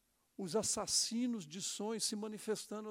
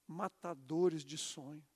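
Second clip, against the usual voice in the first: slow; 4 semitones lower, 4 dB quieter, slower than usual. Shown as a demonstration. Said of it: "repeated phrase".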